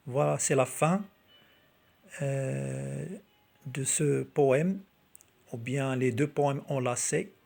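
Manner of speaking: ordinary talk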